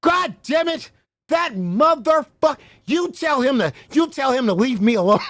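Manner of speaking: hammed-up angry outburst; imitating angry caller. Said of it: "I ain't never seen him so angry".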